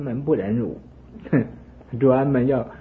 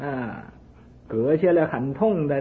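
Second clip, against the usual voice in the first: ordinary talk, nothing marked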